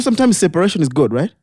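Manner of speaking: ordinary talk, nothing marked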